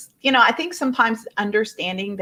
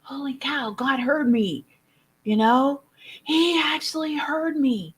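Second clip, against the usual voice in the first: high, awed disbelief; amazed; 7 semitones higher than usual. Retold or imitated. Recalling her awe that God heard her, high and amazed. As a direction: gasp it out like a miracle happened. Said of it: "I was like, I mean, shocked".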